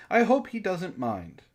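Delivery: ordinary talk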